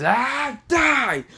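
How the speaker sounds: high, loud shriek of rage; furious villain yell. Acted. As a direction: shriek the attack. Frieza firing beams.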